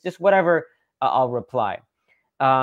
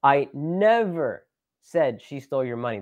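long, drawn-out "never" in firm denial; stress on NEVER. Shown as a demonstration. Showing complete denial to listeners, stress on "never". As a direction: hold and punch "never", slowly. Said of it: "That's, like, complete denial, rejection".

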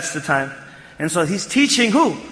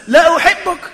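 "La uhibbuk" high and loud; emphatic; 10 semitones higher, 6 dB louder than usual. Shown as a demonstration. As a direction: punch out "La uhibbuk" at raised pitch. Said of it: "another tawqeed or another emphasis".

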